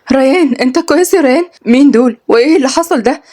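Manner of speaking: anxious questions at his bleeding nose; frightened. Acted. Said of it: "قالت بخوف".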